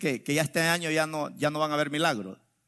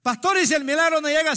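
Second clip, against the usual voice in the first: congregante que pregunta; 10 semitones higher, 5 dB louder, faster than usual. Retold or imitated. Voices a congregant's worried question.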